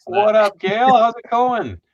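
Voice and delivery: high, loud, hearty greeting; calling out hello. Real, not acted. Host greeting a viewer who joined the chat, calling out. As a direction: holler it across to a friend.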